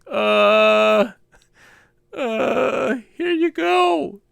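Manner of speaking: high, drawn-out meek surrender; mock terrified. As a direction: play a scared culprit sheepishly handing it over. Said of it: "was terrified".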